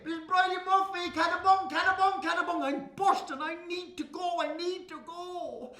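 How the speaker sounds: high frantic pleading, fading at the end; urgent begging. Acted. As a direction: beg because you're bursting. Boy bursting for toilet.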